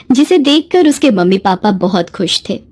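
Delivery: plain reading voice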